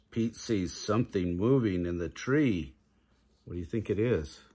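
ordinary delivery

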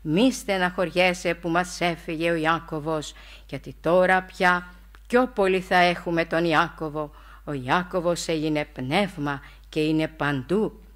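slow and grave, each word stressed separately; serious, word by word. Retold or imitated. The elder comforting his grieving disciple, slow and grave. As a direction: lay down every word deliberately and seriously. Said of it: "με ένα ύφος σοβαρό, τονίζοντας μία-μία τις λέξεις".

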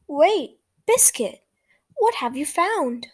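lively read-aloud for small listeners; reading to children. A narrator's reading. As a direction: read it brightly to little kids. Reads picture book to children.

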